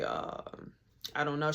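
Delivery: very low, soft, stalling; retold awkward mumble; sheepish evasion. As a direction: act out having no good answer. Caught out, has no answer.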